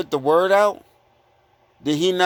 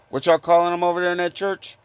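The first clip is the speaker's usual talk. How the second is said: usual speaking voice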